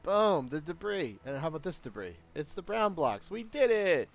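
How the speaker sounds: high, loud victory cheer; jubilant, punchy gloating; excited triumph. Real, not acted. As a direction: celebrate a win, pitch leaping up. Celebrating code that works in game.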